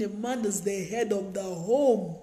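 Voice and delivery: slow, deliberate statement to be learned; slow teaching voice. Shown as a demonstration. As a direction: lay it down slowly, like a lesson. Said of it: "We should learn that".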